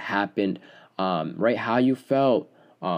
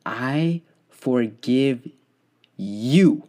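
very slow, stretched-out words; drawn out; 2 semitones higher, much slower than usual. Shown as a demonstration. Host showing the words to write at the bottom, drawn out. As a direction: let each word hang, slowly. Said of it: "you have to put".

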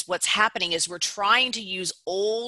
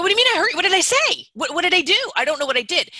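much higher, louder startled protest; defensive disbelief; 8 semitones higher, 5 dB louder, much faster than usual. Retold or imitated. Accused person reacting to ouch, much higher and louder. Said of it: "And they go".

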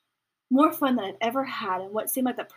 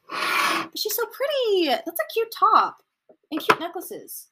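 high, slow gushing over her looks; gushing admiration; 10 semitones higher, 4 dB louder, slower than usual. Real, not acted. Reacting to her picture, high slow gushing. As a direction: coo over the photo. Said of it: "admiring photo".

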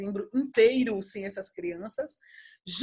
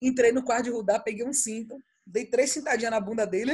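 ordinary talk, nothing marked

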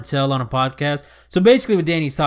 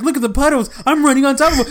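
excited; 9 semitones higher than usual. Retold or imitated. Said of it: "He's like".

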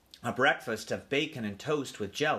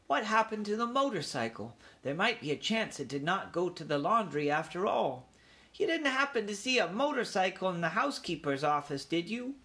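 put-on venturing young mouse; 7 semitones higher than usual. A narrator's reading. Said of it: "he ventured".

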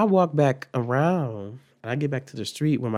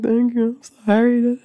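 high, loud imitation of tearful thanks; mock crying; 9 semitones higher, 6 dB louder than usual. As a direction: wail it like a drunk crying apology. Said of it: "Then I'm crying and shit".

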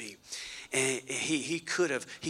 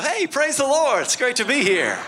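bright put-on church cheer, higher and louder; fake cheerful greeting; 8 semitones higher, 7 dB louder than usual. Churchgoer greeting the greeter, falsely cheerful with a laugh. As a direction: switch to a sugary grin. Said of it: "and you go".

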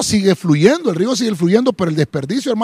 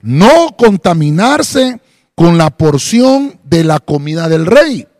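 slow, slightly louder Bible reading; scripture reading; 3 dB louder than usual. Acted. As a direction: read the verse deliberately. Reads Daniel from Reina Valera. (lectura bíblica pausada)

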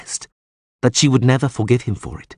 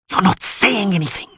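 djinn-voiced woman hissing, much higher; hissed; 11 semitones higher than usual. A narrator's reading. Sorceress hisses at the Witcher to tempt him. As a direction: hiss it like a possessed sorceress. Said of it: "she hissed".

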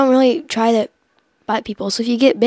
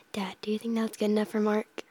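unvoiced whisper aside; 11 dB quieter than usual. Real, not acted. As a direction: whisper it so he can't hear.

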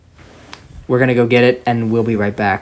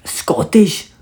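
mock Scottish; 7 semitones higher than usual. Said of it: "it sounds kinda Scottish".

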